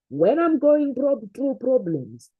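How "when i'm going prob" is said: ordinary talk, nothing marked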